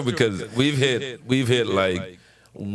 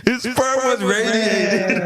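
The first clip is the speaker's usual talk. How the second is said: high, slow, laughing through it; 8 semitones higher, slower than usual. Real, not acted. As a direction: repeat it while cracking up. Laughing repeat of punchline.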